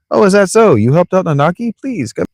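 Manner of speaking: high, impressed character voice; surprised gatekeeper. Acted. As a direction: react in a high, swooping pitch.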